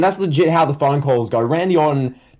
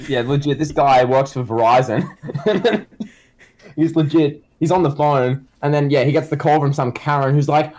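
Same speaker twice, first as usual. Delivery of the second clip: ordinary talk, nothing marked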